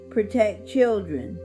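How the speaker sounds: plain reading voice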